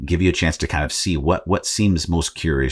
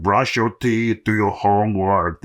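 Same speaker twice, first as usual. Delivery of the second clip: put-on higher, slow hammy Austrian accent; Arnold Schwarzenegger parenting; 5 semitones higher, slower than usual. Dad bosses his kids as Arnold, hammy Austrian accent. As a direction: order the kids around in a terrible Austrian accent. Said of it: "terrible Arnold Schwarzenegger impersonation".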